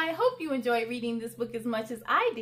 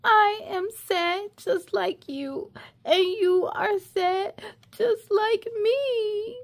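slow, whispered pretend sob; teary half-whisper for the kids; mock crying whisper; 5 semitones higher, slower than usual. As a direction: hush it through fake tears. Whispered, crying sad refrain.

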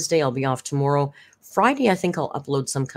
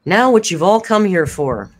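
announcer voice; 4 semitones higher, 8 dB louder than usual. Real, not acted. Announcing the Rio mukbang.

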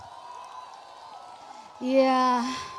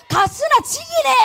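much higher, loud shout of 가스나 지기네 in Busan dialect, stress on 지기네; 10 semitones higher, 4 dB louder than usual. Shown as a demonstration. Audience member shouting Busan praise at the singer, loud dialect. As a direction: yell 가스나 지기네 like a proud Busan local. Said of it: "부산 사투리로는 제일 칭찬하는 말이 뭐예요".

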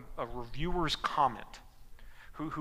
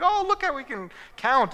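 much higher and louder showy brag; mock proud boast; 10 semitones higher, 7 dB louder than usual. Family showing off the dog to guests, hammed-up higher brag. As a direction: show off the trick like proud trainers. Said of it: "And like".

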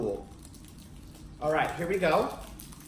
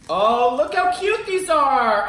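delighted gush; 5 semitones higher, 7 dB louder, slower than usual. Real, not acted. Admiring cute cookies.

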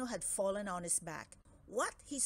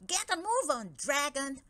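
loud, higher barked order; bossy shout; 4 semitones higher, 6 dB louder than usual. Acted. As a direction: drive the dragon on with a shout. Franz spurs dragon.

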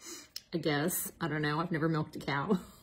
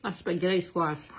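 talking while chewing; words round a bite of food; mouth full; 4 dB louder than usual. Real, not acted. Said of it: "talk with your mouth full".

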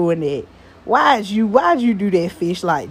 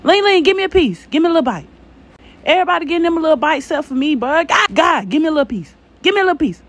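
higher, pleading whine for a bite; playful begging; 7 semitones higher, faster than usual. Pretend. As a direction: beg like a kid wanting a taste. Begging the cook for food.

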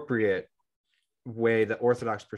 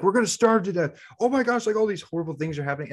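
high, fast panicked wail of a frantic wife; mock-panic, voice shooting up high; hammed-up hysterical wife; imitating screaming wife; 10 semitones higher, faster than usual. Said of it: "she starts screaming at the husband".

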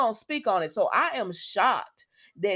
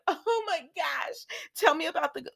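mocking Taylor; 7 semitones higher than usual. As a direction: ham up her eager gushing. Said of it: "I'm making fun of Taylor".

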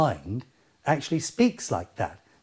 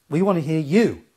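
ordinary talk, nothing marked

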